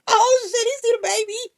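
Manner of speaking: loud, high alarm; panicked yell. Retold or imitated. Gorilla grabs the baby, loud panicked cry. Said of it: "I was like".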